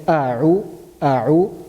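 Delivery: "a'u" with a glottal catch, then a throaty ayn squeeze; hamza then ayn. Shown as a demonstration. Teacher shows the student the hamza and ayn contrast in "a'u". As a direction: move from the bottom to the middle of the throat on "a'u". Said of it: "first we are pronouncing from the bottom of the throat".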